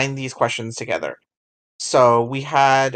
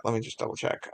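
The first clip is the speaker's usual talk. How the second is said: ordinary talk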